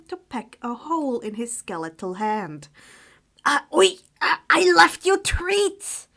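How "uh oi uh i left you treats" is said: startled yelp. Acted. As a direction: yelp as the beak bites. Harry scolding owl pecking hand.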